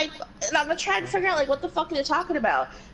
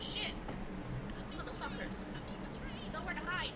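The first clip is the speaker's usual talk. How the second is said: much softer, half-whispered cursing; muttered under breath; 4 semitones higher, 16 dB quieter than usual. Real, not acted. Gamer muttering curses at traitors, softly. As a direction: grumble to yourself at the screen. Muttering to himself while playing.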